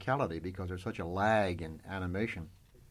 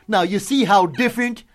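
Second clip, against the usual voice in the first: much higher, much louder cartoon moose voice; Bullwinkle puppet voice; 10 semitones higher, 15 dB louder than usual. Puppet answers Red Skelton's lawyer on air, cartoon voice. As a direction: boom it out like a goofy cartoon moose. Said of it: "the puppet goes on the air".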